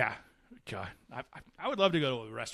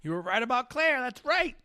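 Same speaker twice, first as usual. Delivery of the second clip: high-pitched, delighted confirmation; excited agreement; 13 semitones higher than usual. Real, not acted. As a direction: seize on the reminder with rising excitement. Delighted agreement with co-host's reminder.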